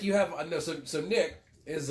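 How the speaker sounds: ordinary talk, nothing marked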